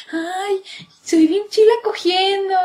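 lightly sing-song the airy brag; mocking girly voice. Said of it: "Y las viejas sí, son así como que".

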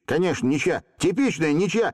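high, insistent exclamation; calling after them. A narrator's reading. Stepan Timofeevich declaring a draw, shouted. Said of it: "крикнул им вслед".

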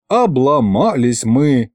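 ordinary delivery

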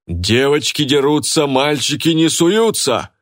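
high, slow, drawn-out bellow; roar. A narrator's reading. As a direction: holler it like a battle cry. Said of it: "взревела Лика". (протяжный боевой рёв)

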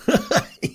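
quick amused chuckle; a brief laugh before the quip; short laugh. Acted. Demon killed, narrator laughs.

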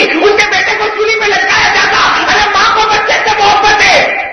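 high, loud impassioned shouting; preaching at full force. Acted. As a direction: pour everything into the sermon. Preacher's rising climax. (پورے زور سے پرجوش خطابت)

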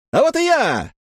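high, loud showman's cry; loud shout. A narrator's reading. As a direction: announce yourself to the crowd. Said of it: "громко крикнул хозяин".

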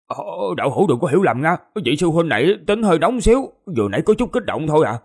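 fast, high-pitched placating; hurried explanation. A narrator's reading. Companion excusing his hot-headed senior to Thạch Hạo, hurried placating. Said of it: "vội vàng giải thích".

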